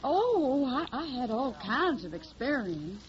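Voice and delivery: put-on slow, drawling Tennessee country girl, Southern accent; put-on hillbilly accent. Hildy poses as a Tennessee country girl, in a put-on Southern accent. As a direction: play the naive Southern hillbilly singer. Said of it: "I got the technique down pat".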